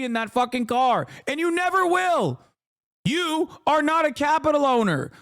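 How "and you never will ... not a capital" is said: angry shout. Real, not acted. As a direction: yell the final insult at him. Ranting insult at Trump supporters.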